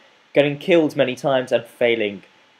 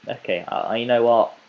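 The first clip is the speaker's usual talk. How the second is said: ordinary talk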